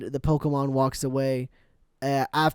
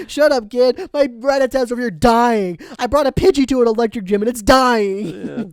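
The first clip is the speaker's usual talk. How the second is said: louder, higher grumpy trainer; mock angry snap; 6 semitones higher, 7 dB louder than usual. Voicing the hospital crowd glaring at Ash, mock angry. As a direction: ham up an annoyed trainer.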